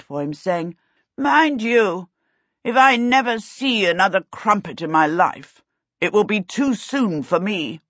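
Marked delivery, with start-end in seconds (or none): 1.2-7.8 higher, emphatic royal grumble; haughty prince in a huff; petulant prince. A narrator's reading. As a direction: declare it with lofty distaste.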